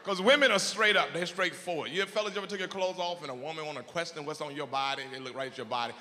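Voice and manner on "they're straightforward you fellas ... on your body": ordinary talk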